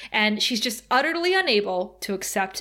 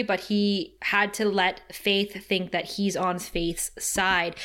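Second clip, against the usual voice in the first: usual speaking voice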